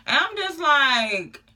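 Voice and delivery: usual speaking voice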